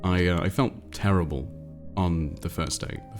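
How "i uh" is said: normal talking voice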